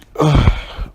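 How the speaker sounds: a long, high sighing yawn out loud. Real, not acted. Hungover host breaking off to his listeners, loud yawning sigh. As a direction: let a big weary yawn-sigh escape while talking to listeners. Yawns mid podcast, hungover.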